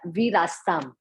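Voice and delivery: "वीरास्ताम" without the a, falling; right form. Shown as a demonstration. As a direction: say "वीरास्ताम", the a moved to मनस्या. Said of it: "हम पढ़ेंगे". ("वीरास्ताम" सही उच्चारण)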